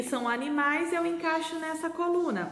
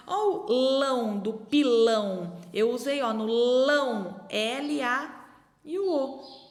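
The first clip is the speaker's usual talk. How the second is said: slow syllable demo; slower than usual. Shown as a demonstration. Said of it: "Usei três letrinhas".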